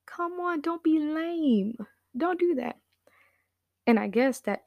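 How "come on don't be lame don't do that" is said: high, slow mock-pleading tease; joking teasing. Dad teasing Q about skipping dinner, high and slow. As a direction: playfully needle him. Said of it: "And my dad's joking".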